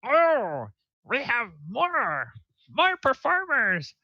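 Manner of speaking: high falsetto gnome cheering; very high, thrilled little-creature voice; excited gnomes. Acted. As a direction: squeal with delight as an applauding gnome. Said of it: "they're like".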